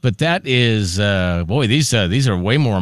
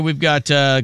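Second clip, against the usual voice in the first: normal talking voice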